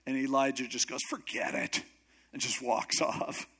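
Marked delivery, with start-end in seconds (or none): 1.1-1.8 low, dismissive brush-off; bad attitude. Elijah refuses Elisha's goodbye request, bad attitude. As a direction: wave him away in a low voice. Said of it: "with a bad attitude".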